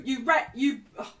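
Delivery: usual speaking voice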